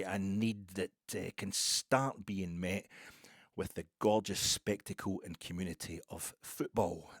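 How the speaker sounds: slow, weighty oratorical Scottish delivery; formal speech. Acted. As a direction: declaim it slowly like a speech.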